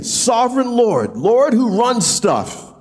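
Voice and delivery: high, slow pleading cry. Retold or imitated. Amos crying out to the sovereign Lord, high and slow. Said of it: "he cries out again".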